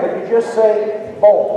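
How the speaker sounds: bon said with no n sound, nasal vowel only; silent n. Shown as a demonstration. Performer showing the audience how bon sounds. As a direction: drop the n in bon. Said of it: "like the N's not there".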